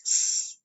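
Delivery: short single "S" hiss; "S" as one short hiss; single s sound. Shown as a demonstration. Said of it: "just says one S".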